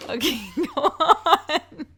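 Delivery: slow, giggling through words; laughing. Real, not acted. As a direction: try to talk while laughing. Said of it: "without laughing".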